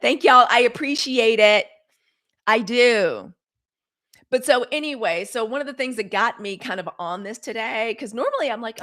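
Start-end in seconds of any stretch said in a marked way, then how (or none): 0.0-3.3 loud, drawn-out shout-out; calling out thanks. Real, not acted. Streamer thanking a viewer who just joined, loudly calling out. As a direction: project big thanks to the room.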